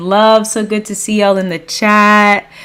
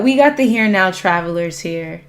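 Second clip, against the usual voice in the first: ordinary talk, nothing marked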